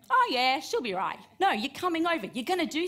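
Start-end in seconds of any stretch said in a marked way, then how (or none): 0.1-1.2 mock dismissive. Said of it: "Are you gonna go".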